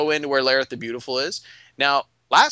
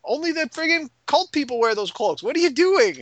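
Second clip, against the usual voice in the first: high-pitched mock outrage and alarm; freaking out; 12 semitones higher, 3 dB louder than usual. Townsperson to players wearing cult cloaks, freaking out. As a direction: scold them in a high, panicky voice. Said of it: "people are freaking out".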